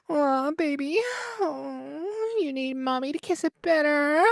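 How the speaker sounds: sultry porn voice, very high; kissing it better. Pretend. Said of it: "the stupid porn voice".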